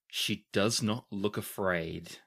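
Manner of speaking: ordinary talk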